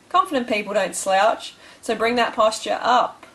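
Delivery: ordinary delivery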